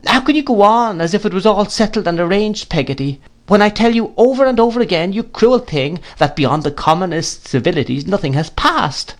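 tearful reproach. A narrator's reading. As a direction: scold through tears. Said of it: "shedding more tears".

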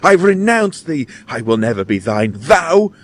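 high, swooping dramatic declaration; melodramatic cry. A narrator's reading. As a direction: cast him off like a tragedy heroine. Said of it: "cries Tom's young lady".

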